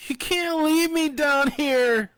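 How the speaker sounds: high, loud, slow cry. A narrator's reading. Fanny crying out as the men leave her. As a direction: cry out desperately. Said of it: "Fanny cried".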